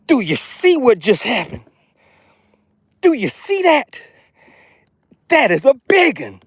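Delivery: high, incredulous showing-off; thrilled, high-pitched disbelief; amazed excitement. Real, not acted. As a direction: marvel at it in a high voice. Shows off huge catch to viewers.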